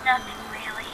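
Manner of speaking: a faint echo of the last words; echoed repeat. Acted. Echo flower repeating the fairy's words, faint and distant. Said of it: "It's an echo flower".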